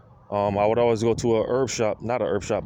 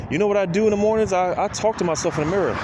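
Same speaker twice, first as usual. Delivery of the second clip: animated retelling; 7 semitones higher than usual. Retold or imitated. Retelling advice to his friend, eager and quick. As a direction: tell her eagerly what you do. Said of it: "I told her, like".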